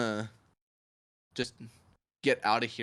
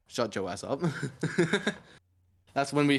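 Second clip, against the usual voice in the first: normal talking voice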